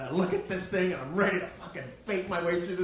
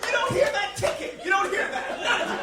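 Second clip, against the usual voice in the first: high, loud laughing disbelief; incredulous rant; 13 semitones higher, 5 dB louder, slower than usual. Retold or imitated. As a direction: shout your disbelief at them. Ranting at neurotypicals.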